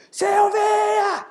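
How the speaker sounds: high, loud screaming mom; furious mother yelling. Acted. Mother yelling threats at her screaming son. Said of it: "Mais ou menos desse jeito".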